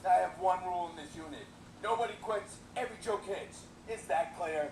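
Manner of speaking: drill sergeant. Acted. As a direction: bark the rules out. Sergeant gives orders.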